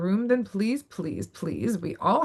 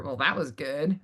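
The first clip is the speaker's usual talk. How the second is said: lower, softer backhanded compliment; doubtful praise; 7 semitones lower, 4 dB quieter than usual. Shown as a demonstration. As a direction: undercut the compliment, pitched down low. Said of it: "did they say it like".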